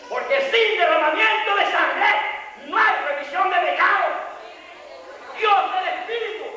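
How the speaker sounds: high, driving pulpit shout; impassioned high-pitched sermon cry; full-force preaching. Real, not acted. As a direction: drive each phrase home at a shout.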